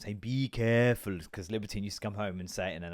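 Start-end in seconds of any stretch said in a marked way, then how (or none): 0.2-1.2 put-on slow Polish-accented be careful; drawn-out warning in a Polish accent. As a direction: mimic the Polish preschool lady, slowly. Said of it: "obviously has an accent".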